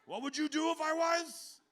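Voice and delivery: high, loud mock grumble; hammed-up cranky father; gruff old man. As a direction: play the cranky old dad shrugging it off. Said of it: "He goes".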